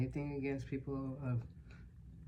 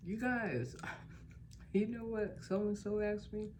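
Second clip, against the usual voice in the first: high, eager call to the room; excited gossip; 9 semitones higher than usual. Retold or imitated. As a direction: announce it gleefully to everyone. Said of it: "I was like".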